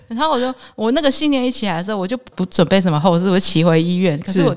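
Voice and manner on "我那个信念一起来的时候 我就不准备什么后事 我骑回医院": usual speaking voice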